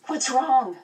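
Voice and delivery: high, anxious question; worried girl. A narrator's reading. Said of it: "she asked anxiously".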